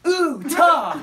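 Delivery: loud, high, hammed-up thrill; eager mom. Mom meeting daughter's friend from England, mock-excited. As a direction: demand it loudly, as if awaiting a treat.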